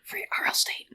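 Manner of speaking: hushed whisper; whispered meme echo. Acted. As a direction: echo the joke in a soft whisper. Joins the whispered joke.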